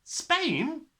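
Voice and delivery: put-on high, startled girl's cry; shocked cry. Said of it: "Matilda cried".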